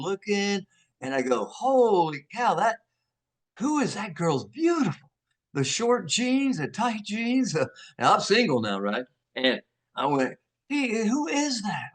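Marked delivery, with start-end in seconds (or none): 1.5-7.5 drawn-out, higher-pitched amazement; mock lovestruck gasping over a girl; retold smitten awe. Retold awe at seeing beautiful girl. 10.7-11.9 high, drawn-out ogling; playfully imitated lovestruck wonder; retold smitten interest. Retold smitten first look at girl.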